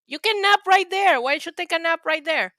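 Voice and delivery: coaxing the dog. Real, not acted. Coaxing her dog to stay.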